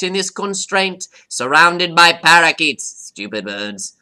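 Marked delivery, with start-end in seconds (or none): 2.9-4.0 a sneering aside, barely voiced; scornful whisper. Parrot whispering an insult about parakeets. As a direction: whisper it under your breath, low and scornful.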